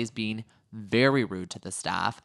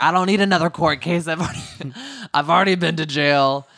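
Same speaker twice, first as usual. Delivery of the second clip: higher, louder mock protest; imitated freak-out; 5 semitones higher, 5 dB louder than usual. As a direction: act out someone freaking out, lightly hammed up. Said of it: "Gina's freaking out 'cause she's like".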